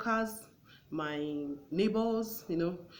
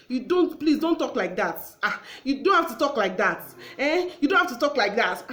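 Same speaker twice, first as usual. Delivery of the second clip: heated, higher and louder protest; indignant wife; 6 semitones higher, 9 dB louder than usual. Acted. Wife cutting off her husband in a quarrel, indignant. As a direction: scold him with raised pitch and volume.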